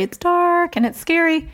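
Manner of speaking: high, mock whiny kid voice repeating the stock answer; obligatory kid answer. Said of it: "I was waiting for the obligatory".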